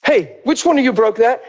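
mock parental interrogation; parent confronting kids. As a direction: act out a parent calling the kids to account. Parent questions children about breakage.

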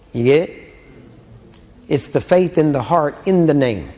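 usual speaking voice

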